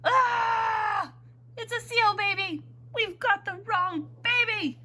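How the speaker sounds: high, alarmed storybook holler; high-pitched panicked exclamation; father's yell. A narrator's reading. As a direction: cry out in shock, stretching the end. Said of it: "And he yelled".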